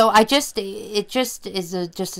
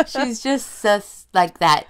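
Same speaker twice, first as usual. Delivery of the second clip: ordinary talk, nothing marked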